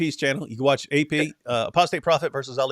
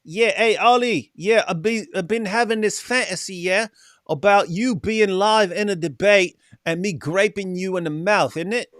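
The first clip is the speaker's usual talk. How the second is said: put-on higher, louder British street accent with bruv; 6 semitones higher, 4 dB louder than usual. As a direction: ham up a cheeky British lad. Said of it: "He's more trash".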